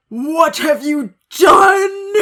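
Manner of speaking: slow, high, loud wailing demand; crying shout. Acted. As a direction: drag it out as a howling accusation. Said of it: "walked in angrily".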